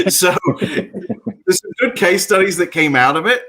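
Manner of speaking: laughing. Real, not acted. Guest telling the hosts about quarantine hotels, laughing. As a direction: still laughing while talking.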